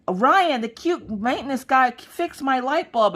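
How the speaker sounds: put-on high, slow, sing-song little girl. Daughter Maggie telling Mommy about the light, high excited little girl voice. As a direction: gush like an excited eight-year-old. Daughter runs to tell her brother.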